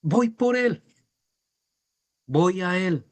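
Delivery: high, urgent excited voice; eager Peter. Acted. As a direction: rush toward him in longing. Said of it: "Pedro dijo".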